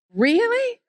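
high mock-surprised squeak. Church greeter answering in mock astonishment. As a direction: jump way up in disbelief.

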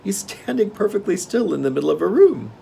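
high-pitched, displeased report; frowning king. A narrator's reading. King reports on the idle machine man, frowning. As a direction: complain in a high royal voice. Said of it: "replied the king with a frown".

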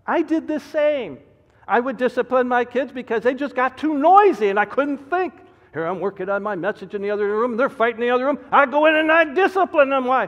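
loud, high, driving pulpit delivery; preaching at full force. Acted. Pastor warning his congregation, preaching at full force. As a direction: hammer the warning home from the pulpit.

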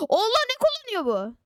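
high, surprised question; astonished. Real, not acted. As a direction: react in disbelief. Opponent's strange fruit.

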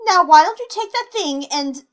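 loud, high furious threat; angry threat. Acted. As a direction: get right in her face, raising your voice. Heated quarrel, threat.

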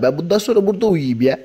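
ordinary talk

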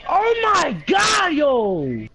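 excited shout. Real, not acted. Excited teammate reacting to a play.

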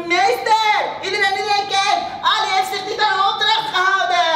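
high, loud schoolboy yelling; shrieking out to the whole class; tattletale's shout. Acted. Class rat telling on Ali to teacher, gleeful shout. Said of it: "die liet er geen gras over groeien en riep".

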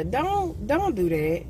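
ordinary talk, nothing marked

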